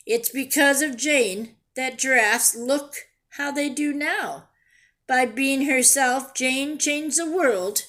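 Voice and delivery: storybook voice, lively and clear; reading to children. A narrator's reading. Teacher reading a picture book to her class, lively storytelling. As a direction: read it like a picture book to kids.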